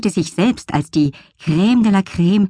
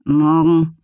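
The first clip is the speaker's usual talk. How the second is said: grumbling. Acted.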